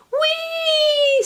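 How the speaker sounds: very high squeal of delight; flying worm squealing with glee; thrilled squeal. Acted. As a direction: squeal as the kite lifts you. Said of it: "screamed the worm".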